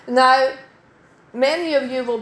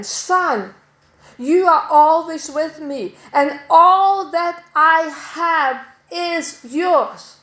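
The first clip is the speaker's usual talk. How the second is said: slow, higher and louder, each word laid down gently; tender father; 4 semitones higher, 4 dB louder, slower than usual. Retold or imitated. Father reassures his jealous elder son, slow and tender. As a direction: reassure him like a loving father.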